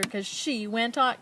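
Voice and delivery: ordinary delivery, nothing marked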